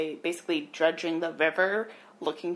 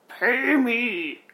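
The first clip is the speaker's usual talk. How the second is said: ghostly demand; 5 semitones higher, 8 dB louder, much slower than usual. Retold or imitated.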